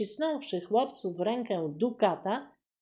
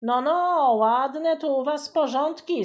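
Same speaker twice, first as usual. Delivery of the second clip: thundering voivode; 5 semitones higher, 6 dB louder than usual. Acted. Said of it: "zagrzmiał wojewoda".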